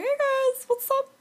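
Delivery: a high, squeaky "Hey guys"; squeaky silly voice. Acted. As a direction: pitch way up like a goofy friend. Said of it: "I have that voice".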